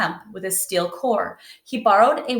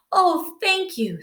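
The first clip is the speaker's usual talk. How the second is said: high, slow, breathy thanks; a young girl touched and grateful; 12 semitones higher, slower than usual. Acted. Girl thanks her master.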